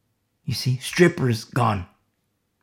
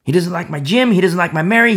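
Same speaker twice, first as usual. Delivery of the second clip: louder stung complaint; 6 semitones higher, 7 dB louder than usual. Acted. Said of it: "godly sorrow acknowledges the same thing".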